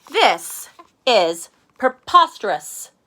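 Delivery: Arthur indignant. Acted. Arthur covered in paint, slow loud outraged voice. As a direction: drag it out in stuffy outrage. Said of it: "Arthur outraged".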